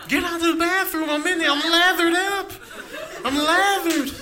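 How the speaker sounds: high, squeaky flustered protest; mock-panicked high voice. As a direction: yelp like you were caught in the shower. Protesting someone entering bathroom.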